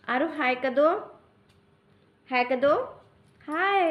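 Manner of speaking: cooing call to little Aru. Real, not acted. Mother coaxing baby to wave.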